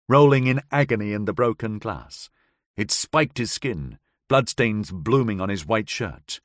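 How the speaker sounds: ordinary delivery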